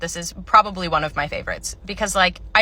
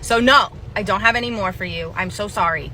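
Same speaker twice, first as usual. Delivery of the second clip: ordinary talk, nothing marked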